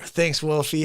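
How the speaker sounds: usual speaking voice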